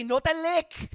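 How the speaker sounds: high, loud Spanglish command to a dog; dog scolding. Acted. Owner telling licking dogs off, high loud scolding.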